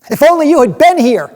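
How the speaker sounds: high, loud sobbing wail; tragic heroine sorrow. Acted. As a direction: cry it out in grief. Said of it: "they sobbed at his feet".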